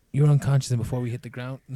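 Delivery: alarmed, rushing insistence; urgent insistence. Acted. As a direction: push it out in a rush. Insists, louder and faster.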